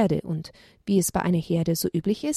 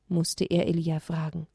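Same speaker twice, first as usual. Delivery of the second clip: usual speaking voice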